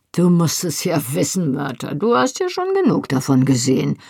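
mocking jeer in a put-on voice; sneering. A narrator's reading. Rupert needles the guard about women, sneering. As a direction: sneer it at him as a taunt. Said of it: "höhnte Rupert".

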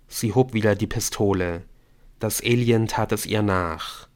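usual speaking voice

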